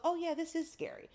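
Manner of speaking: small, high, softened voice; mock scared aside. Angel admits the fall is scary, mock meek.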